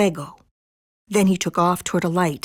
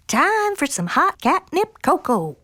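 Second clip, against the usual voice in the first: high, lilting mama's call; 9 semitones higher than usual. A narrator's reading. Mama offers the kittens catnip cocoa, high calling out. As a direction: call them in for a treat. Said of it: "called out Mama Junebug Jones".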